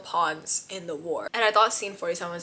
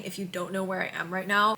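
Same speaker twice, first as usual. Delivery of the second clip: ordinary talk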